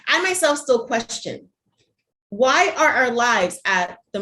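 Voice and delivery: projected spoken-word delivery, lines launched high then falling; poem recitation. Acted. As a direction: recite it to the room, pressing each question. Reads own poem to audience.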